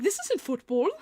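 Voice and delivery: bewildered British impersonation; put-on British accent, baffled. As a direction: mimic a puzzled British soccer fan. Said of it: "so the British guy".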